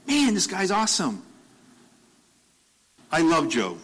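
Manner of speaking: high, delighted boast; proud God. Retold or imitated. God bragging to Satan about Job, delighted. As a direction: brag on him with glee. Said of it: "God's like".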